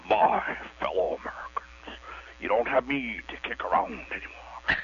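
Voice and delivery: breathy presidential gravity, mock Nixon; Nixon impression. Caller plays Nixon on the call-in show. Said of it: "Okay, here we go".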